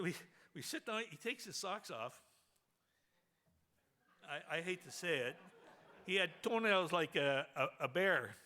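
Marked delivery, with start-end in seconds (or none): none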